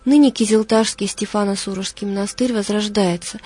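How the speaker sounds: usual speaking voice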